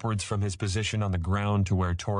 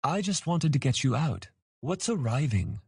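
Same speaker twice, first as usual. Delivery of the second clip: put-on high childlike voice; confused young dragon; 8 semitones higher than usual. A narrator's reading. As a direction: ask innocently like a small child.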